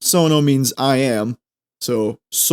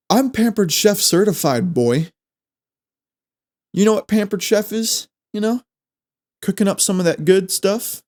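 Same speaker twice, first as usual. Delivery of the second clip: bragging; 6 semitones higher than usual. Acted. Boastful showing-off bit.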